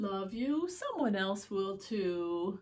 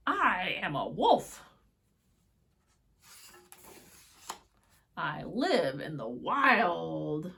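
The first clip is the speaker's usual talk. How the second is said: wolf voice; 4 semitones lower, 8 dB louder, slower than usual. Acted. Wolf speaks in picture book.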